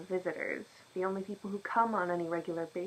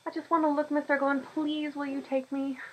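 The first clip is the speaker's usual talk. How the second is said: high, eager begging; a girl's high plea; pleading girl; 7 semitones higher, 4 dB louder than usual. Acted. The girl begging Mister Gowan to take her, high and eager.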